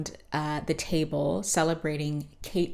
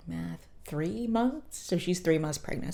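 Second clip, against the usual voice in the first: normal talking voice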